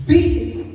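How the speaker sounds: "Basic." said loud and high; emphatic. Real, not acted. Preacher stresses the word basic to the church. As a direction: hit "Basic." hard and loud. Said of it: "Yung gentleness is basic".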